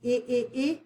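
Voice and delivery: short I sound repeated alone in three separate bursts; the I of LIT, as ih, ih, ih; isolated short vowel. Shown as a demonstration. Teacher sounding out the vowel in lit, short detached ih sounds. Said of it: "And we have lit".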